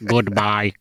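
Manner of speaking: put-on old-school robot goodbye; eighties robot voice. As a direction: imitate an eighties speech synthesizer. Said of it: "Old school's nineteen eighties robot voice".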